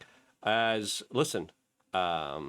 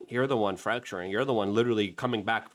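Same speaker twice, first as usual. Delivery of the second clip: normal talking voice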